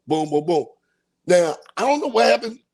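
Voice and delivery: ordinary talk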